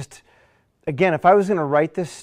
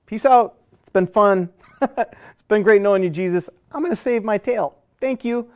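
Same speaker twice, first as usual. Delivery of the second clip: mock-cheerful deserter signing off; flippant mock farewell; 5 semitones higher than usual. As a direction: toss it off like a friend ditching you. Deserting disciples mocked flippantly.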